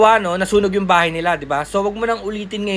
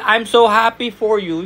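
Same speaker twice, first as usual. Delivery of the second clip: "I'm so happy for you" sung out higher with real gladness; bright, happy read; 4 semitones higher than usual. Shown as a demonstration. Trainer showing applicants the right cheerful tone. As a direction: sound truly pleased for the caller. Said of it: "hindi mo naman pwede sabihin".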